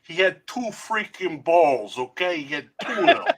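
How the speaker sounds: put-on New York accent. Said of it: "I'm doing the New York accent".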